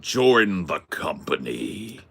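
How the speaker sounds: slow, drawn-out villain impression; Darth Vader. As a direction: play the dark lord recruiting, words stretched out. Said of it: "Darth Vader came to you and said".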